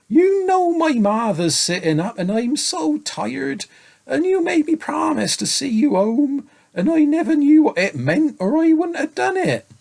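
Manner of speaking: put-on high, whining little boy; tired boy voice. A narrator's reading. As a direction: plead like an exhausted child. Tired boy pleading with dragon.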